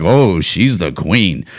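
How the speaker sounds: low, slow mock reverence; mock adoration. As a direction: drag it out in fake awe. Mocks fawning over Michelle Obama.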